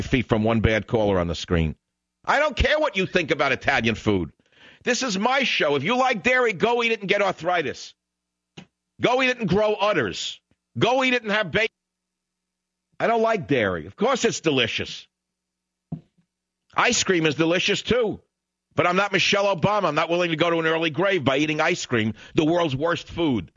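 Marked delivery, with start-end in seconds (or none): 2.2-11.7 high-pitched, indignant tirade; worked-up, scornful outburst; angry rant. Real, not acted. Host rants at an unwanted caller. 13.0-23.5 worked-up, high-pitched protest; exasperated tirade; indignant rant. Real, not acted. Same rant about dairy continues.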